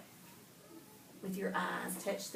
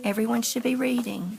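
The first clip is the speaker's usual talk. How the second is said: loud call over the room; loud call to class; 11 dB louder than usual. Real, not acted. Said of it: "teacher calls class".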